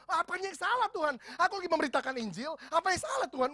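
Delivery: high, indignant protest; mock self-righteous protest; arguing with God. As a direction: defend yourself to God, offended. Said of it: "segala macam argumentasi".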